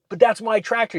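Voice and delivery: high-pitched indignant protest; mock-angry complaint; imitated mad buyer. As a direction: mimic a man getting mad, pitch jumping up. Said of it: "the guy is getting mad".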